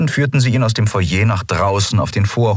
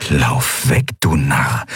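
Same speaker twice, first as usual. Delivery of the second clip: lower, urgent inner voice; scornful inner voice; 5 semitones lower, much slower than usual. Acted. Inner voice scolding Telios to flee, low and scornful. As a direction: growl it low inside his head.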